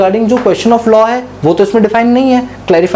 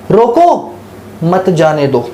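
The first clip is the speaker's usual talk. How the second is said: "रोको" rising, pause, then lower "मत जाने दो"; "रोको" set apart, "मत जाने दो" dropping in pitch; pause after रोको; 3 semitones lower, much slower than usual. Shown as a demonstration. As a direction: break after "रोको", keep "मत जाने दो" together. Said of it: "meaning बदल गया ना एक कॉमा से".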